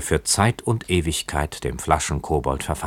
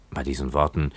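ordinary delivery, nothing marked

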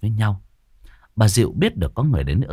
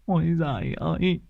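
high, slow mock lament with sobs; hammed-up wailing; much slower than usual. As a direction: wail over the body like a hired mourner. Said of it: "mếu máo". (khóc mếu máo giả vờ, kéo dài)

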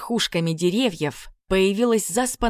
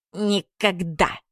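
slow, sinister laughing word; slower than usual. A narrator's reading. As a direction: laugh it out like a villain. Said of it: "зловеще рассмеялся Хряк".

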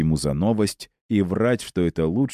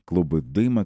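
ordinary delivery

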